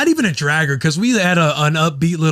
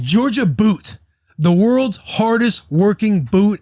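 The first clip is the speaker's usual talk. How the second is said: slow, higher announcer tagline; 6 semitones higher, much slower than usual. Acted. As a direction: sell the slogan slow like a TV spot. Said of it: "let's hit this ad read".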